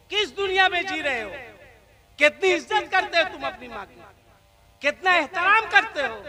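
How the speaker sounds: loud, high fiery sermon rebuke; preaching at full force. Acted. Preacher scolding listeners about their mothers, fiery and loud. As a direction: thunder at the crowd over their mothers.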